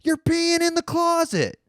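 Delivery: high, urgent friend voice; imitating a freaked-out buddy; alarmed friend. Friend catching him peeing in the closet, high alarmed call. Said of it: "He's like".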